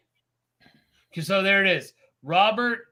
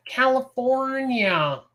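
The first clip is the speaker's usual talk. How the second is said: put-on slow, drawn-out, higher movie-kid voice; movie line quote; 5 semitones higher, much slower than usual. Quoting the California line from the movie, slow and drawn out. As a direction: stretch the word out like the kid in the film. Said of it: "From The Wizard".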